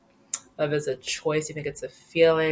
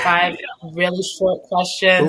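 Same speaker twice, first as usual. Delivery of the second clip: excited; 4 dB louder than usual. Real, not acted. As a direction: announce it like a game show. Excited echo of quiz segment.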